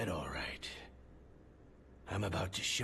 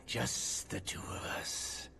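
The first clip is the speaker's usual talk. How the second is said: slow, soft sinister whisper; menacing whisper; 3 semitones higher, 5 dB quieter than usual. Acted. Interrogator to his prisoner, a slow menacing whisper. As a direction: savour being alone with him.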